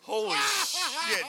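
slow, high, loud holy shit; shocked exclaim. Real, not acted. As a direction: gasp it out, stunned. Reacting to dark joke.

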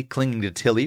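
plain reading voice

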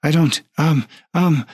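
a nervous attendant losing it; panicked clerk. Acted.

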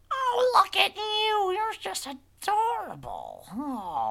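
very high, slow, loud cooing; gushing old witch. Acted. Witch fawning over the visitor's echo, gushing high coo. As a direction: squeal with drawn-out delight over a cute creature. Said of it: "And she goes".

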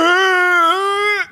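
high groaning scream through unmoving lips; muffled scream. Shown as a demonstration. Showing how he screams half asleep, strained closed-lip scream. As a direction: try to scream but your lips won't move. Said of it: "'cause I can't move my lips".

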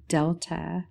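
usual speaking voice

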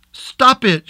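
high, panicked cry of stop it; frightened scream. A narrator's reading. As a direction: scream at him to stop, terrified. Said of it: "she screamed".

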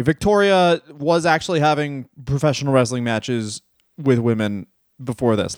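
ordinary talk

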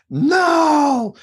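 high, drawn-out cry of warning; acted high-pitched long warning; inner warning voice. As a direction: wail it like a voice in the head. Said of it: "I hear a voice in my head".

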